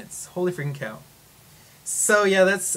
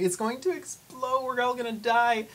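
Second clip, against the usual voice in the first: much higher, slowed, playful dread; mock panic; 11 semitones higher than usual. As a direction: whine it like a dramatic doom prophet. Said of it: "it's just like".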